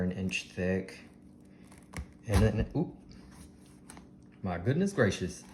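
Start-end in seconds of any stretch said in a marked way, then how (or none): none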